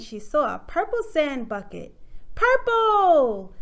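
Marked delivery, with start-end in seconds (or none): 2.3-3.5 loud, high, drawn-out delight; excited exclamation. A narrator's reading. Susie spots a toy in the backyard, excited. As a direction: stretch it out in happy discovery. Child's delighted find in story.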